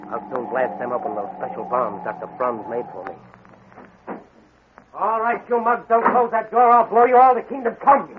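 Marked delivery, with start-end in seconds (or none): 4.9-8.1 loud heroic threat; shouting. Acted. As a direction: bellow the threat at the gang. Hero threatening gang at door.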